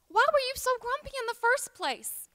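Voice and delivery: put-on high, coaxing old lady. Old lady asks her new mule friend about his temper, high-voiced. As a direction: pitch it well up, gently prying.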